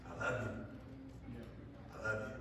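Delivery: soft, low loving reply; hushed tender reassurance; tender love. Retold or imitated. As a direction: speak gently like a loving father. God's reply voiced tenderly.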